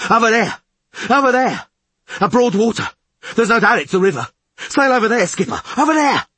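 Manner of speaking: very high, loud lookout's cries; urgent shouting. A narrator's reading. Lookout urging skipper toward broad water, high urgent shouts. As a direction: shout it excitedly from the bow. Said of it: "Fish shouted from the bow".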